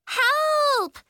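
very high, loud cry for help; high-pitched alarm; freaking out. A narrator's reading. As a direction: shriek like a panicking kid. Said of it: "kids from the other classes were freaking out".

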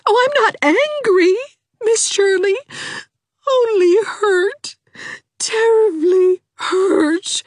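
high, slow, tearful voice; foggy with tears. A narrator's reading. Hazel telling Anne she is only hurt, tearful. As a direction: speak thickly through tears. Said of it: "in a voice positively foggy with tears".

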